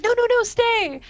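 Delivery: high, eager pleading; eager Minuet. Acted. Minuet urging Picard to stay, eager and high. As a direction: beg the guest to stay in a high voice. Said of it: "then Minuet's like".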